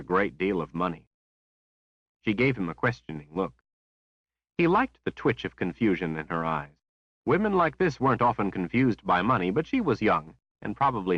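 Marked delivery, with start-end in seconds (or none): none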